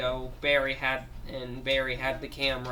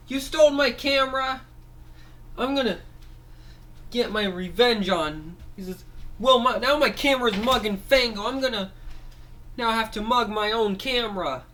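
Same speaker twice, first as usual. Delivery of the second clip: high, louder toy voice, indignant; angry Barry figure; 8 semitones higher, 4 dB louder than usual. Acted. Barry protests that his camera was stolen, high indignant voice. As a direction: complain like a robbed little toy.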